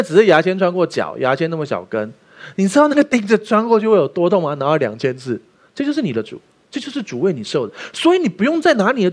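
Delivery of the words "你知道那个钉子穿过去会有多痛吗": ordinary delivery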